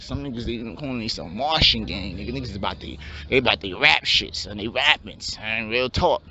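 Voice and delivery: low, laid-back street voice of a hopeful rapper; wannabe rapper. Acted. Aspiring rapper pitches his rap plan to his friend, low and laid-back.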